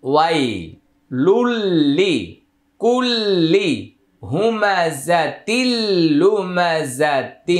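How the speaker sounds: each word stretched long for teaching; slow teaching recitation. Shown as a demonstration. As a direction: drag out every syllable slowly. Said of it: "কিভাবে পড়ছি লক্ষ্য করেন".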